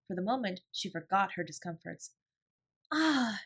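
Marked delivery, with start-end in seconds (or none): 2.9-3.4 high excited gasp; a delighted cry of joy; cried out. A narrator's reading. Sarah spotting the new books, cries out. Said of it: "she cried out".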